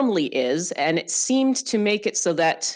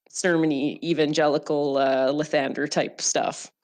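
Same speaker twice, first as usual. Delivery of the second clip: usual speaking voice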